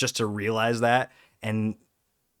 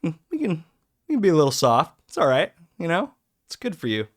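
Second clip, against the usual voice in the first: gentle self-talk; 7 semitones higher, 6 dB louder, faster than usual. Retold or imitated. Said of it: "it's like".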